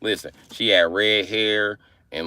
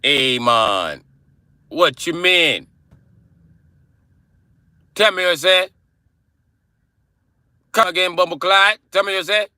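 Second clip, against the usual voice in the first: high, loud mock Jamaican accent; indignant put-on Jamaican patois; 10 semitones higher, 4 dB louder than usual. Shown as a demonstration. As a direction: challenge them in a Jamaican accent. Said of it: "Jamaican accent sounds like me British accent".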